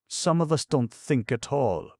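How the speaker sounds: high, loud and biting; acid remark. A narrator's reading. Pat cutting into the men's talk, acid. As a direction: sneer it at the group. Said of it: "put in Pat acidly".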